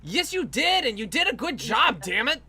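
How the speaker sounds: normal talking voice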